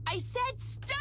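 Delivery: high, yelled stop; screamed stop. Acted. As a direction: scream it out to end it. Final outburst at the double.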